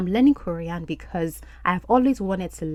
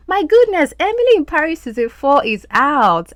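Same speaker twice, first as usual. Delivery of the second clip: much higher and louder, bursting with excitement; thrilled announcement; excited outburst; 9 semitones higher, 7 dB louder than usual. Real, not acted. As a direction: blurt out the big news with delight. Excited news about new season.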